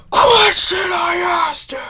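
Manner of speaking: loud, strained superhero shout; Batman. Acted. Grandmother demanding an answer from OP, in a shouted Batman voice. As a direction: blow up into an over-the-top vigilante roar. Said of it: "why am I trying to do a Batman voice".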